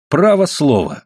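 plain reading voice

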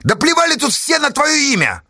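high, strained shout; exasperated high-pitched outburst; exclaiming. A narrator's reading. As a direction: cry out scornfully, pitched right up. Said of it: "воскликнул писарь".

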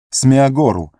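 high desperate shout; desperate call. Acted. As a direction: call out in despair. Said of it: "В отчаянии Фродо". (отчаянный зов)

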